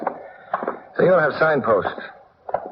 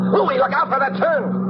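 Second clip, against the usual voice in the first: urgent warning; 12 semitones higher, slower than usual. Acted. Warning the driver.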